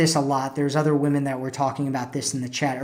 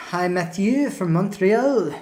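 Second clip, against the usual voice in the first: light mock French accent, drawn out; 3 semitones higher, slower than usual. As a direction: ease into a butchered French accent. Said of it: "this is probably my butchered French accent".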